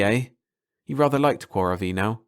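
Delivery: usual speaking voice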